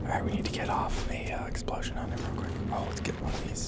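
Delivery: soft unvoiced whisper. Real, not acted. Player sneaking up on a monster, whispering.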